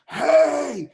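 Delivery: high, loud yell of hey; screaming. Retold or imitated. A dead man screams at his wife, high and loud. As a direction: shout hey! at the top of your voice. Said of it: "He's screaming at his wife".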